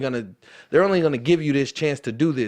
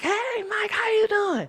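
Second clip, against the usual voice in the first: much higher, squealing mom voice; excited mom. Retold or imitated. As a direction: play an overjoyed mother shrieking hello. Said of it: "My mama is so full of energy".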